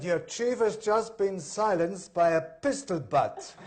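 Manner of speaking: put-on loud, high, slow German-accented announcement; drawn-out, emphatic German accent; German villain accent. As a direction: relish it as a comic German villain. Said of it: "Can you do a German accent".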